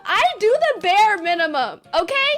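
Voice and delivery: high, protesting mock outrage; indignant self-defence. Fired daughter defending her work to mom, hammed-up indignation. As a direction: argue back as if wrongly accused.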